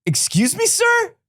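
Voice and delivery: shrill mock disbelief at the word; mock surprise. Host reacts in disbelief to the singer's accent. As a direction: pitch leaps up on a squeaky sir. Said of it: "And I was like".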